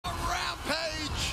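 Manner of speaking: long, slow, shouted hype call; fighting game announcer. Acted. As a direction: stretch it out like an arena announcer. Hype call of a big play.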